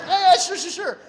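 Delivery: loud, high, tumbling assent; eager retold agreement. Medics agree to patient's prayer request, loud eager assent. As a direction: agree loudly, tripping over the repeats.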